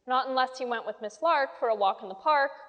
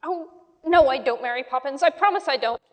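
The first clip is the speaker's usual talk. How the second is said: pleading; 2 semitones higher, 8 dB louder than usual. A narrator's reading. Children pleading with Mary Poppins.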